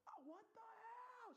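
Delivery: very high, drawn-out mock shriek; high, slow, hammed-up alarm; imitating shocked girl. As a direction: imitate a girl's panicked cry, way up high. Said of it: "the girl's like".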